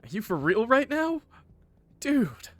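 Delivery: high-pitched exasperated disbelief; real incredulous complaint. Real, not acted. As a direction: react in disbelief, pitched right up. Incredulous reaction to the story.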